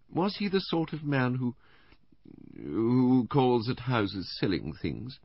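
high, halting invalid questioner; Missus Bland, hesitant. A narrator's reading. Invalid wife probing the inspector about the caller, hesitant. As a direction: ask tentatively, breaking off midway.

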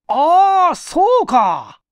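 high, loud burst of realization; a loud ah of discovery, then slow; sudden realization. Acted. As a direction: it all clicks at once, slow on the second part.

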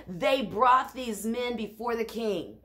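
ordinary delivery

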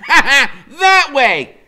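high, loud laughing then commanding; a boy laughing off a joke, then leading on; Freak laughing. A narrator's reading. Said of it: "Freak laughs and says".

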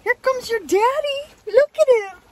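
high, loud gushing; excited puppy talk. Real, not acted. Showing puppy Eleanor her daddy, excited high baby talk. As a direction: hype up the puppy to greet someone.

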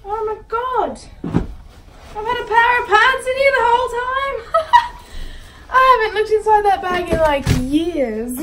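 high, loud, amazed outburst breaking into laughter; loud astonished laughing discovery; real laughing surprise. Real, not acted. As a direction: exclaim in delighted disbelief. Finds pants in long-forgotten bag.

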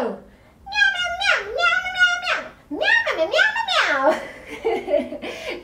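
high, squeaky kitten meows; a string of silly high meows; cat meows. Pretend. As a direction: meow like a playful kitten, giggling. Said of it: "And say meow".

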